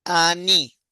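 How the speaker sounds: "ani" said loudly and clearly for repeating; model word. Shown as a demonstration. Teacher leading class repetition: loud, clear "ani". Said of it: "repita conmigo".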